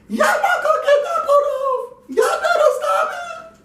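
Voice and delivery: very high, laughing, crying mock lady voice; scolding neighbor lady. Lady watching from her house scolds them in a high squeal. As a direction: squeal it like a hysterical busybody.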